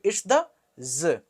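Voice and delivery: ordinary delivery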